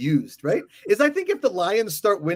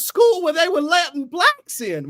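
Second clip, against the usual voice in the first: put-on old Texan voice; 11 semitones higher, 6 dB louder than usual. Mocking Jerry Jones voice.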